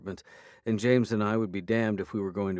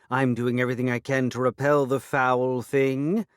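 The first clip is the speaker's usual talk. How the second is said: fairy voice; 4 semitones higher, 6 dB louder than usual. A narrator's reading. Fairy angry reply.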